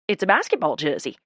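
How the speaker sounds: mildly innocent reply; a touch of guileless innocence. A narrator's reading. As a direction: answer as if nothing were odd. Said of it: "he says innocently".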